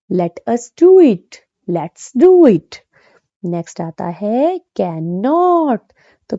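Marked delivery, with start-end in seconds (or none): 0.1-2.8 slow and louder, words pulled apart; slow model pair. Shown as a demonstration. Teacher modelling full and short forms slowly. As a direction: say both forms slowly and clearly.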